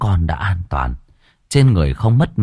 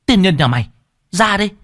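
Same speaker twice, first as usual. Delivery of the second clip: barked higher at the hidden figure; angry shout; 10 semitones higher, faster than usual. Acted. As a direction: yell him out of the bamboo. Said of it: "mà quát".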